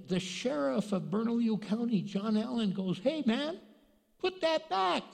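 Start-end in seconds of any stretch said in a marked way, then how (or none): 3.0-5.0 imitated sheriff's high-pitched order; retold challenge. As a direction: call out a thief, pitched high. Sheriff confronts thief in retelling.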